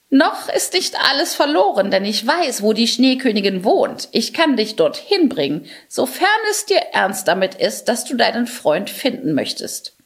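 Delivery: a helpful crow lifting her spirits. Acted. Crow character speaking.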